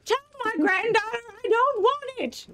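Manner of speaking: put-on high defiant old lady shout; defiant grandma. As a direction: refuse like a stubborn granny. Granny refusing nursing home.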